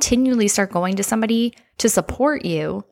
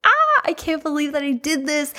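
squealing with delight at first, then hushed in disbelief; a giddy high gasp that sinks to an awed hush; reenacting a proud, thrilled moment; celebrating; 7 semitones higher than usual. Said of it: "you were celebrating and you were like".

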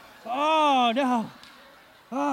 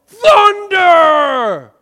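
big drawn-out yell of "Thunder!", high and loud; 7 semitones higher, 13 dB louder than usual. Shown as a demonstration. Showing the kids how to yell "Thunder!". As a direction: roar "Thunder!" like the storm. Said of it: "you all yell".

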